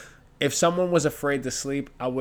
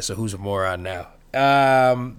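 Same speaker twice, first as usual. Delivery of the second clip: normal talking voice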